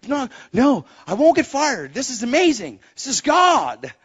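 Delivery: loud, high and eager; bursting with joy at the job; excited. Retold or imitated. Husband telling his wife, excited and loud. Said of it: "self quoted".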